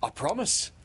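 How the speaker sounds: put-on high, earnest boy's pledge; high-pitched childlike vow; loyal promise. A narrator's reading.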